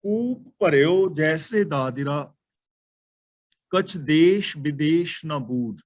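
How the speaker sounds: slow measured recital of the verse; slow devotional recital of the tuk; verse recitation. Acted. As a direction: lay out each word of the holy line slowly. Recites verse line of Gurbani.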